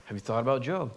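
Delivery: easygoing God to Satan; casual. Retold or imitated. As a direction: mention Job lightly, no fuss. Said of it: "Such casualness".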